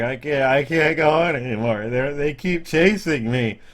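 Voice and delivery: put-on high, loud, tearful little boy; crying child voice. Game master voices the injured boy to the party, tearful and high. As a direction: whimper it like a hurt kid. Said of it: "tears from his eyes".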